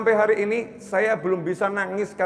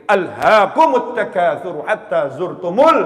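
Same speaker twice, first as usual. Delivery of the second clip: loud, drawn-out verse recitation; Quran recitation; 8 dB louder, slower than usual. Acted. Preacher quoting scripture to the congregation, loud recitation. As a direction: recite the verse forcefully, stretching the syllables.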